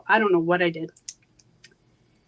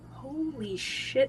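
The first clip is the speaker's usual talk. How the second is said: slow, soft, awed; awed hush; 4 semitones higher, 7 dB quieter, much slower than usual. Retold or imitated. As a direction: draw it out in quiet wonder. Reliving awe during self-hypnosis.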